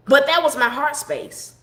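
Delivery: ordinary talk, nothing marked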